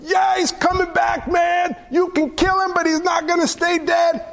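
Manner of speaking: hammed-up bold cheer of sure faith; confident shout. Disciples imagined boasting he'll rise, mock triumphant shout. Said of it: "they weren't like".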